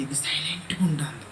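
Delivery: soft voiceless whisper; aunt whispering her scheme. Retold or imitated. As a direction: keep it hushed and secret. Aunt's secretive reply.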